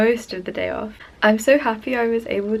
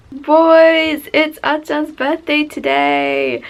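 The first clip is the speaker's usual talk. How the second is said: much higher and louder, slow squealing call; excited shout; 7 semitones higher, 11 dB louder, much slower than usual. Real, not acted. Fan calling out to the boys about the birthday, excited squeal. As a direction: shout the news to everyone.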